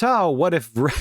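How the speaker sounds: normal talking voice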